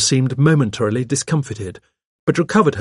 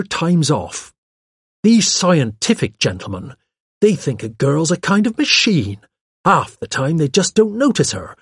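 higher, self-satisfied secretary; pert secretary, pleased; 6 semitones higher, 4 dB louder than usual. Acted. Secretary boasting of her independence, pert and pleased. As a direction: boast like a proudly independent girl. Miss Cram's dialogue, clearly pleased.

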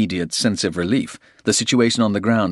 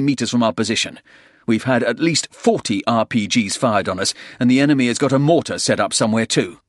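raised, urgent military report; embattled soldier reporting; 5 semitones higher, 4 dB louder than usual. Acted. Porter lists enemy forces to the colonel, urgent soldier. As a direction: rattle off the threat in a higher, urgent voice.

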